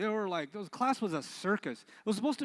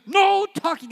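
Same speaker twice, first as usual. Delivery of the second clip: authoritative shout; 13 semitones higher, 14 dB louder than usual. Shown as a demonstration. Teacher finally laying down the law with a shout. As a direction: bark "No talking!" with full authority. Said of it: "I had so much authority when I said".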